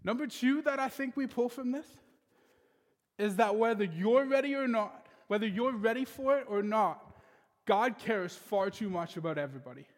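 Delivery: full preacher's cadence; preaching. Acted. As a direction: preach it to the congregation with conviction. Preaching the sermon point.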